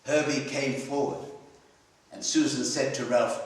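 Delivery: ordinary delivery, nothing marked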